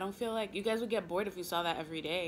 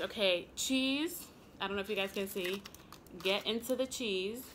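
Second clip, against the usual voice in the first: ordinary talk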